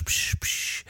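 soft unvoiced psch sounds; imitated hushing. Trainer mimicking Novak's hypnotic hushing, soft unvoiced psch. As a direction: hiss psch like calming a dog. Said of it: "die Frau Novak mit dem Psch".

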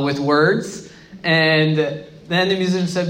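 ordinary talk, nothing marked

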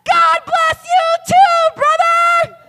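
high, loud, drawn-out sweet blessing; mock blessing shout. Pastor shouts a blessing back at the cursing driver, loud and drawn out. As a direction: kill the cursing driver with kindness at full volume. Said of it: "And I smiled".

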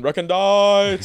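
hammed-up word.

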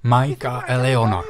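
ordinary talk

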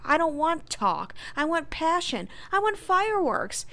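high, emphatic acted complaint; exasperated demand. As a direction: build in pitch to a frustrated demand.

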